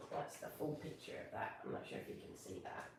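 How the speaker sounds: soft unvoiced aside; whispered aside. Real, not acted. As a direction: whisper it as a side note. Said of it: "aside whispered to camera".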